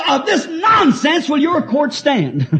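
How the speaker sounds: slow, high, loud courtroom outburst; indignant old attorney. Retold or imitated. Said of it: "He said".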